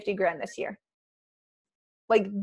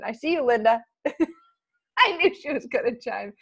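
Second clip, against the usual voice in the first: ordinary delivery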